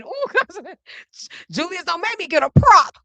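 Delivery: ordinary talk, nothing marked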